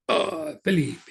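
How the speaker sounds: put-on high, excited Italian accent. Italian priest hearing of his Oxford colleague, excited Italian accent. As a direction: exclaim it with charming Italian delight. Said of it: "get very excited in an Italian way".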